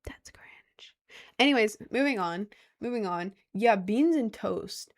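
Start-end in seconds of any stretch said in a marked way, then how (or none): 0.1-0.9 barely audible self-conscious whisper; whispered inner thought. Retold or imitated. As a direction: whisper it like a private worry.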